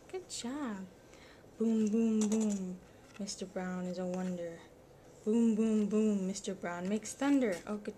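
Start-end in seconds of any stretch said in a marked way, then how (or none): 1.6-2.8 thunder booms. Acted. Parent reading to child, loud thunder booms. 5.2-6.3 thunder booms. Acted. Parent reading to child, loud thunder booms.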